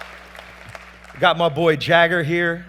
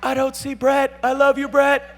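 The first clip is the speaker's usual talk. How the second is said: high call out; 11 semitones higher, 5 dB louder than usual. Real, not acted. Calling to unseen friend.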